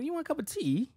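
high-pitched mock British accent; proper British. Friend mimicking proper British speakers, mock British accent. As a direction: put on a prim British tea offer. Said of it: "The proper, like, the motherfuckers that".

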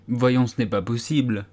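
usual speaking voice

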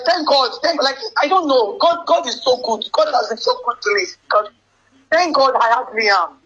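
usual speaking voice

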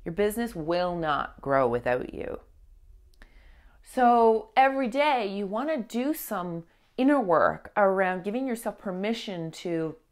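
usual speaking voice

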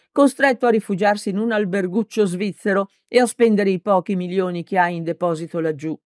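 plain reading voice

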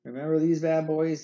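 normal talking voice

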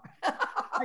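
breathy little laugh; quick unvoiced chuckle; short laugh. Real, not acted. Laughs at own star remark.

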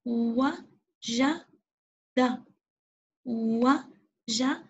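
وجد stretched very slowly; slow blending. Shown as a demonstration. Teacher slowly sounding out وجد for children. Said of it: "نعم يا معلمتي".

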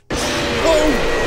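loud, high shocked cry at a jumpscare; startled yelp. Real, not acted. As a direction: yelp as if something leapt out at you. Jumpscare by Bonnie.

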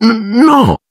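un "no" spezzato, molto alto, quasi singhiozzo; la voce si rompe sul no; singhiozzando. A narrator's reading. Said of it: "singhiozzò Lavanda". (Lavanda risponde a Hermione singhiozzando)

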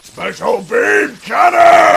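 huge, high, drawn-out yell; slow, full-force battle cry; attack shout. Acted. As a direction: scream the attack name as you fire. Fires attack.

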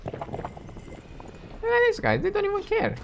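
squeaky high voice. Real, not acted. Marvels at enemies ignoring him.

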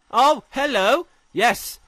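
high, loud greeting; loud, high-pitched triumph; delighted discovery. Real, not acted. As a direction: cry out on spotting the target. Monster finally found.

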